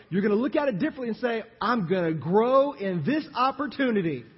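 loud, high, driving sermon delivery; full-force preaching. Real, not acted. Preacher declaring he will grow through disappointment, full-force preaching. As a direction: build it into a loud, urgent declaration.

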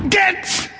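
sharp high shouted Get!; frustrated shout. Retold or imitated. The frustrated lady shouting her dog back home. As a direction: bark it at the dog to chase him off. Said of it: "out of frustration, the lady said".

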